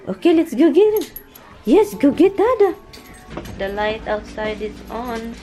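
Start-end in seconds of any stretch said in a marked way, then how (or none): 0.1-2.7 high and loud excited coaxing; excited pet talk. Real, not acted. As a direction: get the dog thrilled. Excited call to dog.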